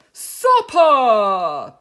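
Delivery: huge loud boom; a bear bursting out to scare the cubs. Acted. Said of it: "boomed Boris".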